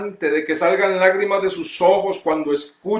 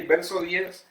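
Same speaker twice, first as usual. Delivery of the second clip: normal talking voice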